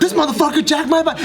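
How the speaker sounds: high-pitched indignant yelp; outraged outcry. Retold or imitated. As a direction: cry out as the theft hits you. Realization turns into outcry.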